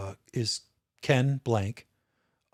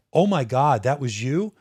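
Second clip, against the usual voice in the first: a loud, higher mock gasp of surprise; retold surprised exclamation; 3 semitones higher, 8 dB louder, slower than usual. Nurse realizing the trooper saved her patient, surprised and loud. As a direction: replay the nurse's stunned "that was you". Nurse learns he did CPR.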